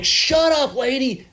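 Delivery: high, loud exasperated outburst; retold angry shout. Passenger telling off the Amtrak conductor, retold angry shout. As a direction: re-enact shouting someone down.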